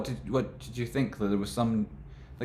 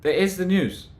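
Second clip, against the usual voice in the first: higher, louder; exasperated exclaim; 10 semitones higher, 7 dB louder than usual. Real, not acted. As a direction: blurt it out in disbelief. Exasperated rant peak.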